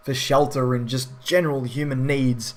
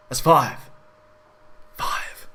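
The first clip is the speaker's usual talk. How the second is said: unvoiced whisper to himself; whispered aside; slower than usual. Real, not acted. Reviewer confirming the army count to himself, whispered.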